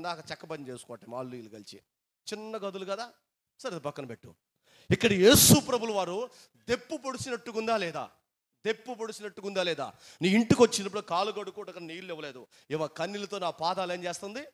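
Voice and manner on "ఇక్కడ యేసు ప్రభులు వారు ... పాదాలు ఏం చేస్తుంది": a preacher's rising, forceful climax; full-force preaching. Acted. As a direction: drive the point home to the congregation.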